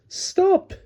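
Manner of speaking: very high shout; Alice shouting stop; alarmed cry. Acted. Alice cries out.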